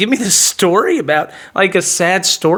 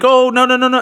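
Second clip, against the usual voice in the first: higher mock protest; quick run of no's in her imitated voice; 6 semitones higher than usual. Woman brushing off man's suggestion, imitated protest.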